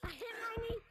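hammed-up cries about a knee; mock pain. About the character hitting its knee, mock pain yelps.

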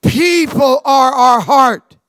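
"People are our heart" called out loud and slow; rally the church with "People are our heart"; shouted creed line; loud slow declaration. Shown as a demonstration. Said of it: "Say it out loud".

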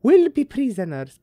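high, loud, over-the-top letter reading; theatrical high-pitched character, all over the place; Maggie's letter voice. Acted. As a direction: declaim the letter in a high put-on voice. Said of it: "what's with up with that voice".

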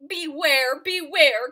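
loud, drawn-out warning; loud warning call. Acted. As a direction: call out to warn the bear. Bees warn the bear.

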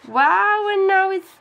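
high, loud burst of wonder; surprised wow. Real, not acted. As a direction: gasps it out at the new color. Color turns lime.

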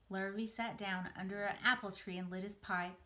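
usual speaking voice

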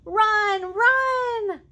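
high, loud urging cry; excited shout. Acted. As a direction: yell it like cheering a race. Reading farm book excitedly to kids.

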